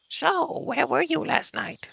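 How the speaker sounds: put-on high, slow old lady voice. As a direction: play a stern old woman. Voices Olga Dmitrievna.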